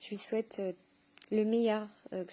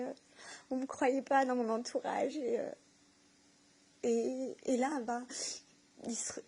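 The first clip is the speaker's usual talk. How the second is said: tearful, pitch raised, halting between phrases; moved to tears; 3 semitones higher than usual. Real, not acted. Woman recalling being misunderstood and regaining her faith, tearfully moved. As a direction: keep talking through real tears of emotion. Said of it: "c'est vraiment des larmes de joie".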